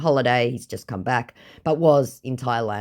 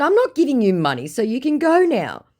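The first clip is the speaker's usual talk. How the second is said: higher, louder, fast refusal; fast firm refusal; 11 semitones higher, 4 dB louder than usual. Retold or imitated. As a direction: shut him down quickly. Original reply vs recap.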